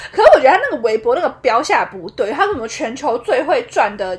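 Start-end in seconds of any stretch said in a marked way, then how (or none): none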